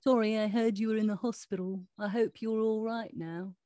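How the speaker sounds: talking cat in a put-on British accent. Cat's video message to girl in hospital, mock British accent. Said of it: "I decided to give him a British accent".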